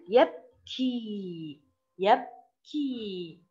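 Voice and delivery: "يبكي" slowly split as "yab-kii"; slow "يبكي" right down, stretching the final vowel; syllable by syllable. Shown as a demonstration. Teacher sounds out the word for pupils, slow split syllables. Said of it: "أين المقطع الساكن؟".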